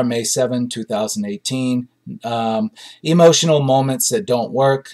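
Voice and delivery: ordinary talk, nothing marked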